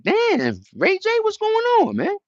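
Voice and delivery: squeaky, very high surprised cry; mock shock. Streamer reacting to [name], very high mock disbelief. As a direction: shoot way up in disbelief. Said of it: "I'm like".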